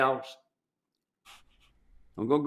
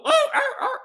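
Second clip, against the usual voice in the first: very high, louder imitated dog yelp; 3 dB louder than usual. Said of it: "you hear this dog go".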